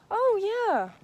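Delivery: sarcastic. Real, not acted. Sarcastic reply to the fan.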